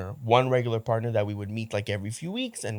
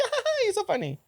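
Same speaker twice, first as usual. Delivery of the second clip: high, fake laugh to brush it off; laughing it off with a nervous giggle, much higher; forced laugh. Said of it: "I was always laugh it off".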